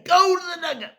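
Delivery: high shouted order; umpire scream. Retold or imitated. Umpire sending the batter to the dugout, loud high yell. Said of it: "He would scream it".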